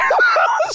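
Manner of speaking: shrill burst of real laughter; can't hold it in, squeal; high squealing laugh. Real, not acted. Recalling a scene, high squealing laugh.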